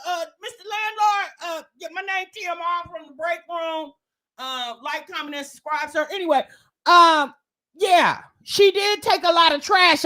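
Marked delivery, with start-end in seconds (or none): 0.4-6.1 mock letter to landlord. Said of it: "I'm say".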